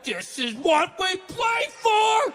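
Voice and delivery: big roaring rally cry, high and loud; game-day shout; pumped-up football yell. Pretend. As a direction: scream it like a linebacker before kickoff.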